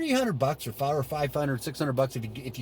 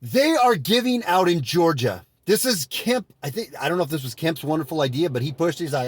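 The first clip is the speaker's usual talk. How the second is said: ordinary talk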